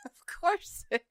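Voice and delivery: laughing high, then a slow dry line; high laugh into a slowed sarcastic remark. Real, not acted. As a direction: laugh off the absurd claim.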